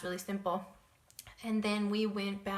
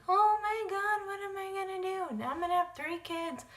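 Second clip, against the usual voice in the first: high, panicky cry of disbelief; panicked disbelief; 11 semitones higher, 4 dB louder than usual. Retold or imitated. Alone with a positive test, panicked high cry. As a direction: squeal it like the test just ruined your plans. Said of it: "I was crying my eyes out".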